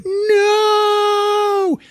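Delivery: very high shrieking no; terrified scream. Retold or imitated. Sarah scrambling back from Arnold, shrieked no. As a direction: scream in mock terror. Sarah seeing Terminator, cry shout.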